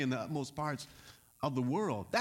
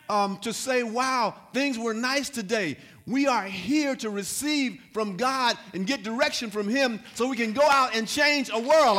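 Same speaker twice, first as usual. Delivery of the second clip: impassioned preacher, louder and higher; forceful preaching; 4 semitones higher, 6 dB louder than usual. Real, not acted. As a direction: build to a loud rallying call to the congregation. Preaches to the congregation with rising force.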